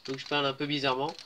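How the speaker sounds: ordinary talk, nothing marked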